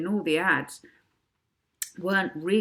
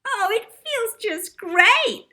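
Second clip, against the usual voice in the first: put-on much higher, loud, slow, smiling child voice; sugar-sweet girl; 8 dB louder, slower than usual. A narrator's reading. Davina gushing to the host about being a star. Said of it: "smiling beautifully".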